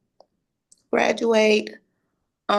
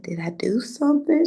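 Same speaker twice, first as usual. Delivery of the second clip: breathy whispered worry, higher; worried whisper; 8 semitones higher than usual. Retold or imitated. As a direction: whisper it nervously, afraid of the answer. Worried question to mom.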